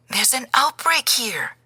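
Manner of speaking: a frightened abuela breaking the news; worried grandmother. A narrator's reading.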